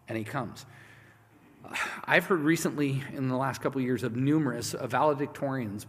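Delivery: usual speaking voice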